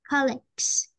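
"colleagues" ending in a clear g-s cluster; G then S ending. Shown as a demonstration. Teacher telling a student what to say, "colleagues" with g then s. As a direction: end "colleagues" on a stopped g, then hiss the s. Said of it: "we will say G and then S".

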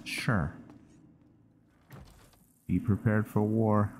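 ordinary talk

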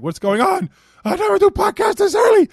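high-pitched, mounting outcry; exaggerated startled wail, rising high and loud; mock panic. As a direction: play up being shocked by the alarm. Acting out reaction to his alarm.

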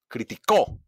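"criticó" punched on the last CÓ; stress on final CÓ. Shown as a demonstration. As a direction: land hard on CÓ in "criticó". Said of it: "hago un golpe de voz". ("criticó" con golpe de voz en CÓ)

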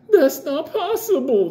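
high, slow mocking imitation of the agent; imitating the agent. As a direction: mimic her, high and drawn out. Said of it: "That was her fucking voice".

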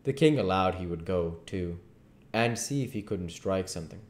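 ordinary delivery, nothing marked

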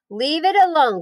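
high firm command. Acted. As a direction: order him to stop. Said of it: "commanded Fern".

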